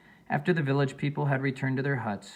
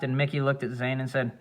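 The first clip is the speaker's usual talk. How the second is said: usual speaking voice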